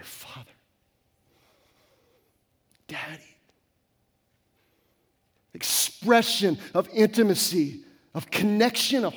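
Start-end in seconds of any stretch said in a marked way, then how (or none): 0.0-3.4 very soft, intimate whisper; hushed whisper. Real, not acted. Pastor showing how to call on God, whispered.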